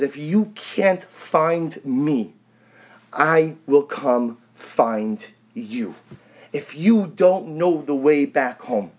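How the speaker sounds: very slow, measured promise; God's reassuring answer. Acted. God answering the plea of the lost, slow and reassuring. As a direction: answer word by word, drawn out. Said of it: "what the Ribono Shel Olam answers".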